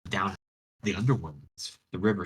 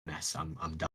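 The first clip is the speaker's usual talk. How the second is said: mild despair; 3 semitones lower, 10 dB quieter, much faster than usual. Retold or imitated. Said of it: "staring at this pile".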